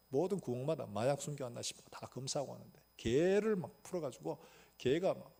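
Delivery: ordinary talk, nothing marked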